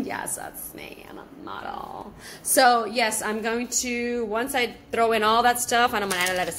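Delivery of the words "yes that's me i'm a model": breathy secret whisper, slowing down; playful whisper. Pretend. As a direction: lean in and whisper the joke like a secret. Joking reply about being called Modelo.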